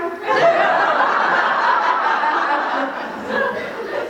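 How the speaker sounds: real high wheezing laugh; laughing helplessly; high breathy laugh. Real, not acted. Laughter at own story.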